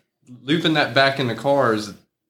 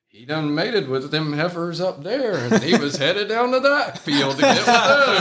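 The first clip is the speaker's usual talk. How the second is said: higher-pitched Southern farmer, laughing through it; Southern country accent; 6 semitones higher than usual. Retold or imitated. Farmer explaining his bull to the driver, Southern country accent. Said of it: "talking with a great Southern country accent".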